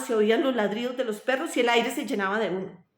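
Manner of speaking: plain reading voice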